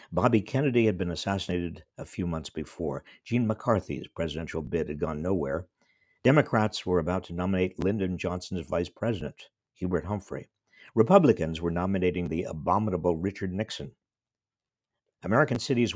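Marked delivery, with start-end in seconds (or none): none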